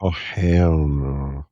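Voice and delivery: long drawn-out, low refusal; emphatic dread. Real, not acted. Listener reacting to a ghost story, slow dramatic refusal.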